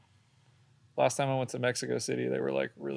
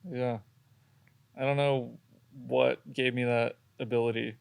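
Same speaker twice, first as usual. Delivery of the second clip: ordinary talk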